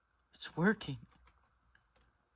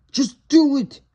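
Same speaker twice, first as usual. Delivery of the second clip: sharp, much higher shout urging a friend on; urgent shout; 12 semitones higher, 6 dB louder than usual. Real, not acted. Friend pushes Michelangelo to weld, urgent shout. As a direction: snap it out, pushing him to act.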